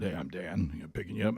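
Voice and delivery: low, soft radio voice; Dan. Retold or imitated. Driver introduces himself at pickup, low laid-back voice. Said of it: "he talked like this right here".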